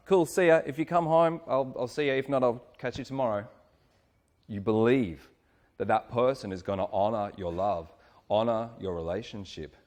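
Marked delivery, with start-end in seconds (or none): 0.1-3.5 higher, louder casual send-off; imitated easygoing reply, starting high and easing down; breezy trusting partner. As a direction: toss off a cheery goodbye.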